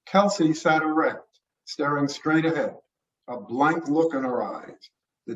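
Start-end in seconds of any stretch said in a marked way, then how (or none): none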